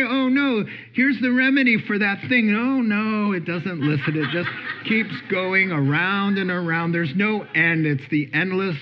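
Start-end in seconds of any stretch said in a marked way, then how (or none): 0.0-3.3 mock worried refusal. Voicing worry refusing remedies.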